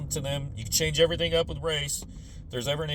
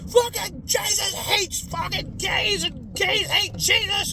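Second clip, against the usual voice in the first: much higher, slow shouted rant; hysterical zealot; 4 dB louder, slower than usual. Retold or imitated. Crazed people in the nineties, high shouted rant. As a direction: rant like a frantic street preacher. People running around panicking.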